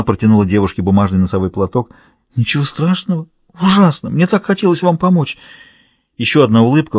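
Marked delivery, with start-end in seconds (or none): 2.3-5.4 higher-pitched, distressed protest; upset. A narrator's reading. Tearful girl protesting that she failed to help, upset. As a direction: throw the reassurance back in dismay.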